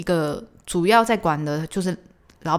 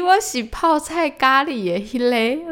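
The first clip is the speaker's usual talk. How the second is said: slow, high, drawn-out patience; weary correction; 8 semitones higher, 4 dB louder, slower than usual. Retold or imitated. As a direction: spell it out for her a third time.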